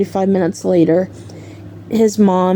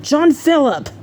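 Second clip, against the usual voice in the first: higher, stern full-name warning; retold scolding; 7 semitones higher than usual. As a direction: imitate mom calling out a liar. Mom scolds son by full name.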